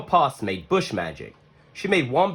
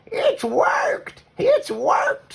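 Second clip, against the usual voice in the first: joyful shout; much slower than usual. Acted. Grandma Poss cries out as Hush reappears, very high joyful shout. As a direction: cheer in delight. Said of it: "she cried".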